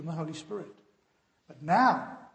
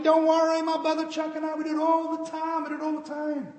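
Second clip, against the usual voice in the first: higher, loud easygoing reassurance; carefree driver; 10 semitones higher, 6 dB louder than usual. Retold or imitated. Driver brushing off his scared friend, breezy and loud. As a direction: wave off the worry, breezy.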